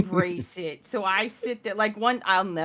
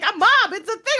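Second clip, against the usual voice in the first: put-on much higher kid voice, protesting; whiny son; 10 semitones higher, 6 dB louder than usual. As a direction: play the twelve-year-old insisting. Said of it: "And he's like".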